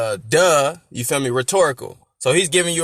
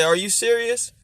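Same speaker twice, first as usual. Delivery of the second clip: doubting hater; 7 semitones higher than usual. Acted.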